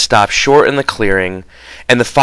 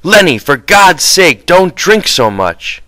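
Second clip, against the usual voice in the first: higher, urgent rebuke; sharp scolding; 8 semitones higher, 3 dB louder than usual. Acted. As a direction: scold him sharply to stop drinking. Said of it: "he said sharply".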